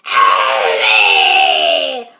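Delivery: long, loud screeching yell; Grandma's yell. A narrator's reading. Grandma just swallowed the awful mixture, long yell. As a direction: yell it out, hugely drawn out. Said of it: "Grandma yelled".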